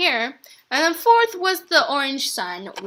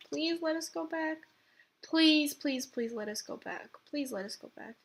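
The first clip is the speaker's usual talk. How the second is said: soft, begging murmur to the game; hushed begging, much softer; quiet pleading; 2 semitones higher, 11 dB quieter than usual. Real, not acted. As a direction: plead under your breath.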